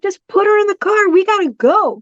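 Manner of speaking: high, quick, insistent; urgent, high-pitched quoting of oneself; retold urgency. Retold urgent decision to leave.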